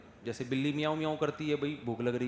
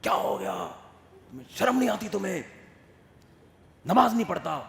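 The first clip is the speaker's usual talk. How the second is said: higher, louder angry scolding; shouting father; 5 semitones higher, 4 dB louder than usual. Retold or imitated. Father yelling at his son about prayers, angry. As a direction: berate the son for skipping prayer. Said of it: "چیخ رہا ہوتا ہے،".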